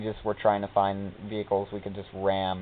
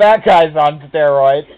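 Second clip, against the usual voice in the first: high, loud and drawn out through laughter; laughing amazement; 4 semitones higher, 11 dB louder, slower than usual. Real, not acted. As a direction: exclaim it while cracking up. Laughing amazement at game character.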